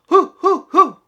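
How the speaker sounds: very high, very loud hoots; hooting rhino call. Acted. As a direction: hoot like a rhino sneaking in. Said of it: "and then the sound".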